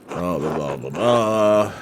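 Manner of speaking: low, soft blah blah babble; mock mumbling prayer. Player skipping the prayer with a low bored mumble. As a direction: mumble through it like a bored churchgoer. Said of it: "I'm just gonna keep typing".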